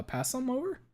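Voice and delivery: squeaky playful request; mock plea. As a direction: beg for a share in a silly high voice. Asks for the alien soup.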